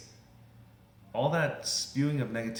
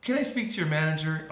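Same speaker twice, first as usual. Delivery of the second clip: higher, louder demand; escalating complaint; 6 semitones higher, 5 dB louder than usual. Acted. Customer demands the manager as things escalate, indignant. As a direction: mimic an indignant customer going over the clerk's head. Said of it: "then things escalate".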